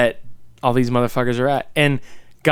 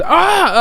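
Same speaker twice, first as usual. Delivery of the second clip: high, louder startled cry; mock jump-scare scream; 5 dB louder than usual. Fan imitating a horror movie jump scare, startled scream. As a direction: yelp as if someone suddenly appeared. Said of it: "somebody's just magically there".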